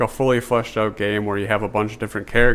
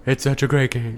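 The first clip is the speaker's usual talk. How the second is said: Trump impression; 3 semitones higher than usual. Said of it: "you're both trying to do a Trump impression".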